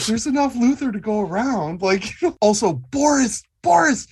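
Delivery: calling out. Acted. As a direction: yell over the brawl. Player yelling to fighting women.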